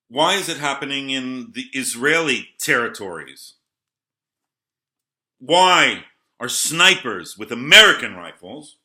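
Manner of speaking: loud, indignant question, rising on American; real indignation; worked-up outburst. Real, not acted.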